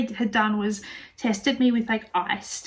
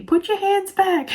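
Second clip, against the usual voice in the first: high, drawn-out mock telling-off; mock scolding; 10 semitones higher, 3 dB louder than usual. Staff telling mother to keep hands back, mock scolding. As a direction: imitate staff chiding, pitched up and slow.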